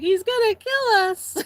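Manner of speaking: high, loud mock-panicked cry; mimicked kid's scream for help; imitated tearful scream. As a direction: cry out like a frightened little brother. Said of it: "screaming and crying".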